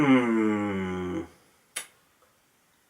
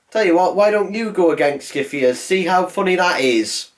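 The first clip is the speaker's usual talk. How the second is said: higher, louder mock complaint; unclassy paraphrase of Basil; 5 semitones higher, 4 dB louder than usual. Cat tells his owner off, crude mock complaint. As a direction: loosely act out an indignant telling-off. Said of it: "he doesn't say it like that".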